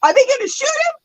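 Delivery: high-pitched alarmed question; retold panic. Asking the dispatcher about police, high retold panic. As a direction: ask it in dramatized high-pitched dread. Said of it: "why I was freaking out".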